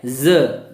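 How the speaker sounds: ज said as a buzzed za, not ja; nukta z sound. Shown as a demonstration. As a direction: give ज the English Z sound. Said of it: "नुक्ता बिंदु लग जाता है". (ज को नुक्ते के साथ ज़ बोलें)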